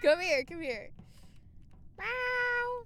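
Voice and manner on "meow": cat meow. Pretend. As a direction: meow like a cat calling for attention. Streamer meowing at a cat.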